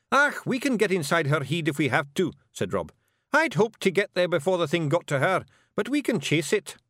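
high, loud wee Scots warrior voice. A narrator's reading. Rob Anybody brags to Miss Level, high Scots Feegle.

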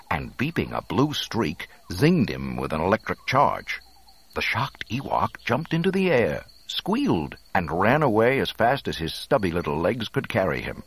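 ordinary delivery

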